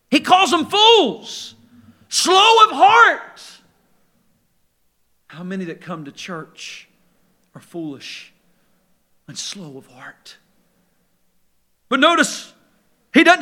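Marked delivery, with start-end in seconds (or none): 0.1-3.4 higher, louder, slowed on "slow of heart"; drawn-out preacher emphasis; slow emphatic repeat. Acted. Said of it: "repeats the rebuke with weight". 5.3-10.4 quiet, probing aside; hushed question. Acted. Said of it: "drops to a hush".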